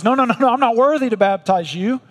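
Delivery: ヨハネが慌てて首を振るように、高く速く「いいえ、いいえ」; humble protest. Acted. John the Baptist refuses Jesus.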